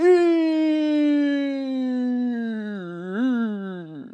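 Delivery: drawn-out helpless laughter; long held laugh. Real, not acted. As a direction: let the laugh run long. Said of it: "ya no me aguanto la risa".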